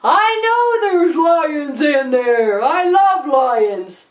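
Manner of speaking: slow, drawn-out holler; Billy Boy screaming. Acted. Boy answering warning about lions, drawn-out mock scream. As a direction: stretch every word in a boastful yell. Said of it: "and he screamed".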